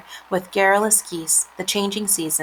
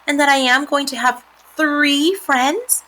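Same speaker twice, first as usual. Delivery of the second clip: higher-pitched pig voice; hopeful pig; 7 semitones higher, 3 dB louder than usual. A narrator's reading. Wilbur asks spiders about staying, high hopeful voice. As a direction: ask hopefully as the little pig.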